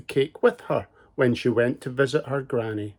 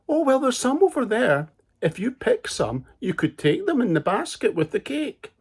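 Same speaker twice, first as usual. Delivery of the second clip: sly wolf; 10 semitones higher than usual. Acted. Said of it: "The wolf said".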